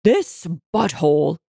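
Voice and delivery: slow, contemptuous accusation; mild narrated shout. A narrator's reading. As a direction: spit out the insult with contained anger. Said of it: "shouted over everyone".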